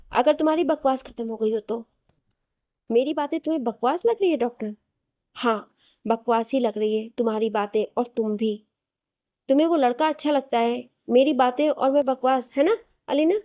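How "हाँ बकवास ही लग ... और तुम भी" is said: plain reading voice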